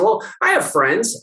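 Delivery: lightly voiced indignant patient; defensive patient. Patient defending herself to her chiropractor, mock indignant. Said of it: "And she said".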